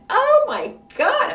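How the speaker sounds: shocked gasp. Real, not acted. Reacting to the story.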